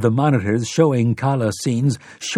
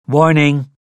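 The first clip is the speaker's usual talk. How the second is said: lightly voiced machine alarm; a hint of a robotic warning; mechanical voice; 3 semitones higher, 5 dB louder than usual. A narrator's reading. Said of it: "said the mechanical voice".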